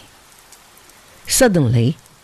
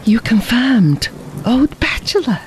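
higher, sudden teasing outburst; Fabian exclaiming; 6 semitones higher than usual. A narrator's reading. Fabian teasing his companion who likes no women, exclaiming. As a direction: exclaim it with lifted pitch. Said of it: "ejaculated Fabian".

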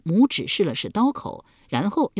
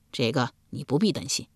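ordinary delivery, nothing marked

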